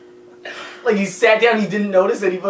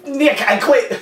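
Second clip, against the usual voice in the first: mock giving up; 8 semitones higher, 4 dB louder, slower than usual. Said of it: "I was like".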